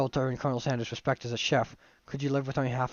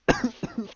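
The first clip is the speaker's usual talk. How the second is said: throat-strained cough; 10 semitones higher than usual. Real, not acted. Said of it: "Oh my god, my throat".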